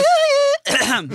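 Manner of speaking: piercing high sung squeal, loud; attempted high note. Shown as a demonstration. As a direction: strain for a note out of your range. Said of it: "that's in your range".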